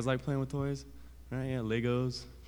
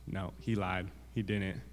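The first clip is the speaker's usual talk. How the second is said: ordinary delivery, nothing marked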